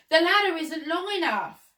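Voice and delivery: high, loud excuse; calling back. A narrator's reading. Gardener explains to the Duke, calling back. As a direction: call it down from up a ladder. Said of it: "the gardener called back".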